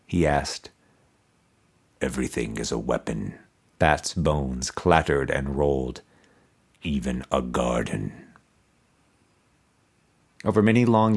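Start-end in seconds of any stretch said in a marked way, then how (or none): 2.0-3.4 low, soft, toneless voice of a buried skull; resonant toneless skull. A narrator's reading. Buried emperor's skull answering Mordecai, low resonant toneless voice. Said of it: "answered in a resonant voice, toneless". 6.8-8.3 low, soft, toneless voice of a buried skull; resonant toneless skull. A narrator's reading. Buried emperor's skull answering Mordecai, low resonant toneless voice. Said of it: "answered in a resonant voice, toneless".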